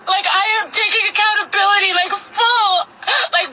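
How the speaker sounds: crying through the words, voice cracking high; begging while she sobs, barely holding it together; sobbing plea. Real, not acted. To her online critics, a sobbing desperate plea.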